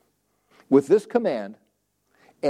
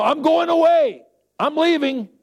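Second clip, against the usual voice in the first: proclaiming; 12 semitones higher, 4 dB louder than usual. Retold or imitated. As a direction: call it out high and loud, as if announcing a departure. Voices Jesus announcing his departure.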